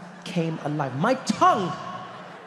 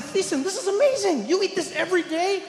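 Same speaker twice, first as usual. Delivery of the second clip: much higher, excited voice; amazed; 13 semitones higher than usual. Retold or imitated. Teller raving to his friend, high amazed gush. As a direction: gush with wonder. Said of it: "And I said".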